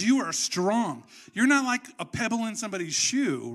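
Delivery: retold pep talk, "strong" stretched out; emphatic affirmation. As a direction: lean hard on "strong", stretching it. Jesus emphatically affirming Peter, retold.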